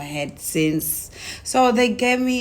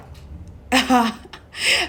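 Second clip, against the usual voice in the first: short laugh; 6 semitones higher than usual. Real, not acted. Laughing at her own story.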